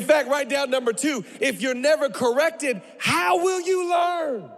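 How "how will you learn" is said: high, slow, drawn-out shout; emphatic shout. Real, not acted. Preacher challenging church on being corrected, emphatic shout. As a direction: cry it out high, stretching each word. Preacher drives the point home.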